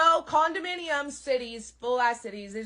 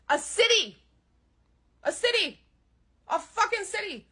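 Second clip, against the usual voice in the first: higher, louder outraged shouting; 9 semitones higher, 5 dB louder than usual. Real, not acted. Comic ranting to the crowd that settlements are cities, outraged shout. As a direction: hammer it home in disbelief.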